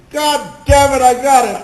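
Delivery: loud, high exultant shout; re-enacted burst of elation; triumphant outburst. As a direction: shout it like you just won the part. Retelling his inward triumph at audition.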